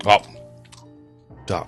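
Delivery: ordinary delivery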